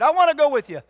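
loud, high begging cry; eager plea. Acted. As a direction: burst out pleading to come along. Healed man begs to follow.

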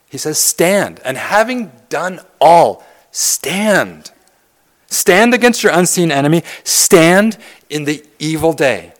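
fiery pulpit preaching; preaching at full force. Acted. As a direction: press the scripture home like a rally cry. Preaches the armor passage.

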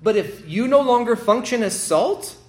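loud, high, ringing pulpit delivery; preacher thundering the scripture at the congregation; full-force preaching. Acted. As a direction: proclaim it to the whole church. Preaching the salt passage.

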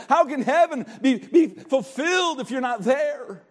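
acting out a pleading talk with an unsaved friend. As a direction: plead as if you can't bear it. Imagined plea to a lost friend.

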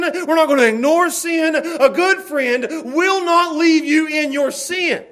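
high, loud shouted pulpit exhortation; fiery shouted sermon, pitched high; full-force preaching. Real, not acted. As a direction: thunder it at the congregation, stretching the key words.

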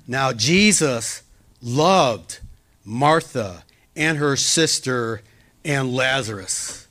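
Jesus loved read slowly and weighted, each name drawn out; a slow, emphatic scripture reading; emphasis on loved. Shown as a demonstration. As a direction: lean on the love, slowly. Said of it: "See the emphasis on the love?".

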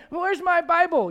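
high, loud, slow, drawn-out complaint; complaining kid. Acted. Grown child asking parents for a lost Bible, complaining. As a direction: play a helpless kid calling out for a parent.

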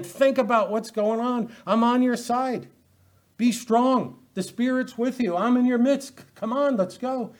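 a prophet spurring the people on; rousing prophet. Acted. Prophets Haggai and Zechariah urging the people, rousing. Prophets rally the returned exiles.

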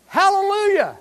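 high, loud praise cry; loud hallelujah. Shown as a demonstration. Preacher leading the reading, loud joyful hallelujah. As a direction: call it out together. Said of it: "follow along till you get to the word".

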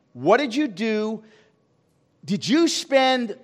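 slow emphatic preaching. Acted. Pastor asking the church what they did with their time, slow and loud. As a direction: hold them accountable, slow and heavy. Pressing the congregation.

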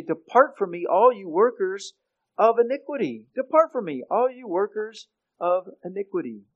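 loud, high commanding cry to depart; forceful rebuke. Acted. Preacher voicing psalmist's rebuke.

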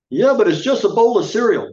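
put-on high, loud, sulky kid talking back; child's whining retort. Child shrugging off the grace, high loud whining retort. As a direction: pitch it up and snap back like a bratty child.

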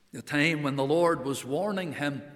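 normal talking voice

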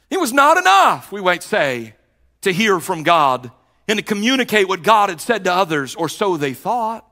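high, loud pulpit preaching building to a peak; fiery preaching. Acted. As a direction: preach it out at full force to the congregation. Preacher builds to full force.

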